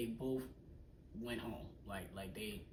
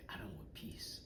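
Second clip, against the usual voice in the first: whispered mock threat; 14 dB quieter than usual. Quoting the coworker's reply.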